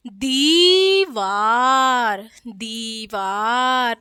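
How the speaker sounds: दीवार very slowly, "deeee-vaaar"; drawn-out long ee and aa in दीवार; taking time. Shown as a demonstration. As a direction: stretch both vowels of दीवार. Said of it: "उच्चारित कर रहे हैं थोड़ा time लेके".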